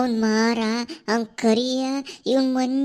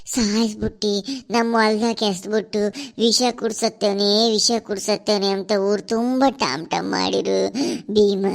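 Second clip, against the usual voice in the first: crying through the words; weeping ghost. Acted. Murdered ghost weeping as he tells Bhima the story. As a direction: sob out the injustice.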